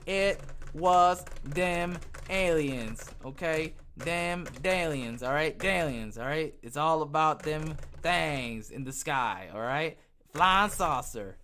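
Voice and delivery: put-on slow, drawn-out thick country accent. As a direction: lay the country accent on as thick as you can. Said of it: "I'd say it with the thickest accent I got".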